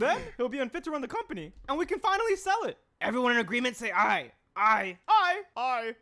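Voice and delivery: high, loud scheming executive; hammed-up boardroom plotter calling the vote; villain boardroom voice. Scheming executives plot to sell company, loud and high.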